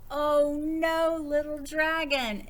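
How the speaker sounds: high, loud, bright announcement of the title; a librarian calling out the book title to children, with big excitement; excited title. Acted.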